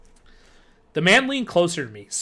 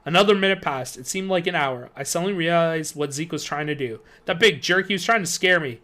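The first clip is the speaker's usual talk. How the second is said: plain reading voice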